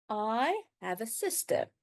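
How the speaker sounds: ordinary talk, nothing marked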